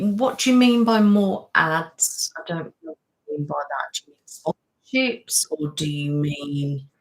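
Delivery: normal talking voice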